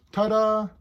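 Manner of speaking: triumphant little "look at that"; showy ta-da. Pretend. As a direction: present it like a magic trick's reveal. Mock fanfare revealing Hulk's instant transformation.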